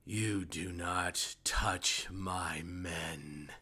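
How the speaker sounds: very slow, deliberate warning; measured in-character threat, drawn right out; threatening foe. Acted. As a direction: space out each word as a command. Game master voices threatening foe behind.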